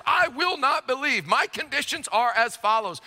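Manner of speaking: high-pitched pompous proclamation; pontificating. Acted. Thomas holding forth to the disciples, pontificating. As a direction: declare it grandly, pitched well above normal talk. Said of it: "sort of pontificating to the disciples".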